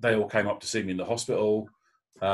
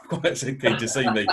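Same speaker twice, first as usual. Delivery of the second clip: chuckling through the words; amused laugh as he says it; light real laugh while telling the story; 4 semitones higher than usual. Real, not acted. Laughing at the nurse coincidence.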